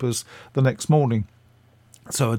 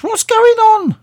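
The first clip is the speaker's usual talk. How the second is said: much higher and louder put-on voice of an elderly actress, asking; Patricia Hayes; 8 dB louder than usual. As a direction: squeak it up high and loud in alarm. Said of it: "And she says".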